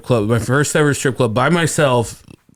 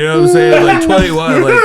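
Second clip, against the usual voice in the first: laughing; 5 dB louder than usual. Real, not acted. Laughing at own youth.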